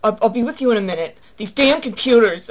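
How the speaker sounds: pissed-off clerk, loud and brusque. Retold or imitated. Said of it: "looking pissed".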